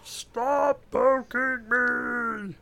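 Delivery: high, very slow exasperated whine; exasperated poked game character. Retold or imitated. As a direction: stretch it out like a fed-up clicked unit. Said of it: "You just keep clicking".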